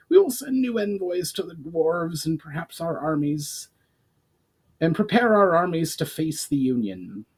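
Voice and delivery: high goblin voice; a goblin chief granting leave; Brawl, formal goblin. A narrator's reading. Brawl continues.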